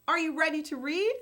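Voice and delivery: plain reading voice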